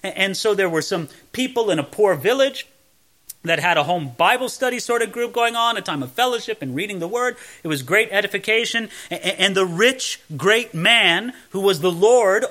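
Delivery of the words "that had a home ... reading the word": ordinary talk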